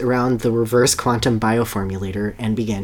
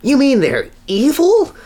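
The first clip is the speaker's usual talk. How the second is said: much higher, alarmed question; hammed-up shocked squeak; high, louder mock alarm; nervous gulp; 14 semitones higher, 5 dB louder than usual. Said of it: "Sonic gulped".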